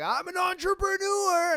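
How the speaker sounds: high, loud, puffed-up boast; mocking wannabe entrepreneur. Mocking a YouTuber who calls himself an entrepreneur, high smug shout. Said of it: "any motherfucker with a YouTube channel is just like".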